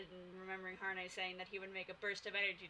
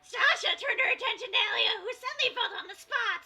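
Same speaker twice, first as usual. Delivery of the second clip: much higher, louder squeaky reading; Toad voice narration; 13 semitones higher, 12 dB louder than usual. Acted. Streamer reading her book aloud in Toad voice. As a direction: read it like a cartoon mushroom. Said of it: "read a bit in my Toad voice".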